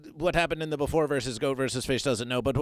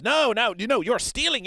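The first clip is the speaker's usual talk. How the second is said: indignant protest; 6 semitones higher, 3 dB louder than usual. Acted. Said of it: "Fish America says".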